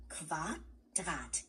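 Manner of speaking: Quadrat said slowly with a rolled German R, kwa-DRAHT; careful German word. Shown as a demonstration. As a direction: say Quadrat as a clear German model. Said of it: "Let's say it together".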